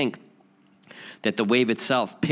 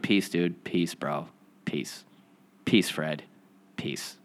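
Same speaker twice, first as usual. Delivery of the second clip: soft, fading whispered peace, each one quieter; 5 semitones lower, 4 dB quieter, slower than usual. Retold or imitated. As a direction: breathe the words out softly, sending silent blessings. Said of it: "I just went".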